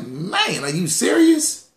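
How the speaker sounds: a retold jaw-drop at a cheap insurance quote; stunned disbelief. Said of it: "I was like".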